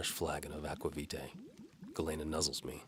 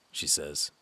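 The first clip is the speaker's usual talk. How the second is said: ordinary delivery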